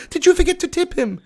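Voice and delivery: high nanny voice cracking into laughter; Mrs Doubtfire, laughing. Acted. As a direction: tease in granny voice through giggles. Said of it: "Missus Doubtfire".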